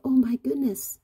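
high exclamation at something scary; demonstrated alarm. Shown as a demonstration. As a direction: exclaim it as a shocked example. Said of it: "something that you go".

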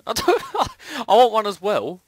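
laughing whine. Real, not acted. Laughing complaint about sword.

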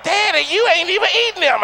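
lightly voiced little girl. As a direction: play the daughter calling out daddy. Little daughter teasing dad.

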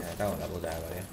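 low, soft frustrated curse; cursing under breath. Real, not acted. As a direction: swear quietly to yourself. Did not get the helmet.

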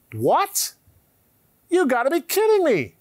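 high, loud incredulous cry; hammed-up shock at Moses and Elijah; mock astonishment. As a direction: act stunned at the news.

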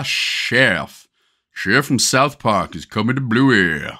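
terrible imitation of a cartoon voice; slow, hammed-up impression, badly faked; Chef from South Park. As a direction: put on a bad cartoon chef accent. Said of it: "That was a terrible chef accent".

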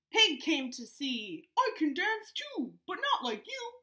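lively storybook read, voices up high; animated read-aloud to a Zoom class; reading to children. Acted. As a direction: read it out bouncy for little kids. Reads picture book aloud to kids.

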